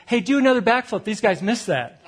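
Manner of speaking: playful shout asking for an encore; calling across a room. Retold or imitated. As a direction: yell the request across the ballroom. Host yells across again.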